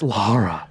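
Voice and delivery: hushed "Lara", LAH-ra; whispered Lara. Shown as a demonstration. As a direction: whisper "Lara" with an ah vowel, low. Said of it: "the way I say Miss Croft's name".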